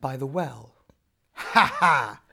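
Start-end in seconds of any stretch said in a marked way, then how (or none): 1.3-2.2 a loud mocking "ha ha" from the water demon; demon's laugh. Water demon laughs at Nora by the well, loud mocking laugh. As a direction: laugh out at her like a gloating demon. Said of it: "laughed the demon".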